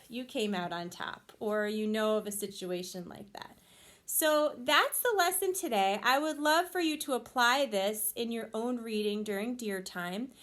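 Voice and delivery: ordinary delivery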